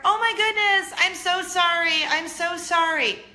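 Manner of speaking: high, loud, gushing apology; apologetic mom upstairs. Retold or imitated. Neighbor upstairs calling down apologies, high and loud. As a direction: call down a frantic sorry from a balcony. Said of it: "then you hear".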